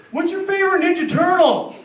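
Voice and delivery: high, loud, eager chatter; chirpy chatterbox. Retold or imitated. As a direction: play the pushy chatty guy, bright and keen. Guy chatting him up mid-run.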